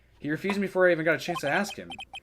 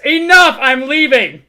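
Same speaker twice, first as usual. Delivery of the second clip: loud, higher outburst; angry shout; 10 semitones higher, 15 dB louder, slower than usual. Acted. Attorney fed up with the lawyer, angry shout. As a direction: storm out yelling. Storms off.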